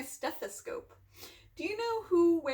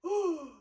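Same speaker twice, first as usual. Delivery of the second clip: acted shocked gasp. Reader reacting to Gerald's sneeze, gasp. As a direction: draw breath in, aghast.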